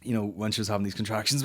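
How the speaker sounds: ordinary talk, nothing marked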